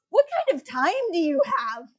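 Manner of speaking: high, loud disbelieving question; incredulous. Retold or imitated. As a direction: push it out in exaggerated disbelief. Incredulous retort to marathon viewers.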